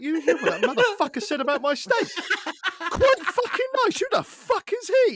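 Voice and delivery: high, laughing mock outrage; mock outraged rant. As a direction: rant in pretend fury to the wife. Ranting to wife after guests.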